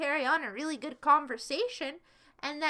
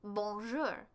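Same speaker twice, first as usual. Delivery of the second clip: mock French; 6 semitones lower, 6 dB quieter than usual. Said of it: "I'm not French".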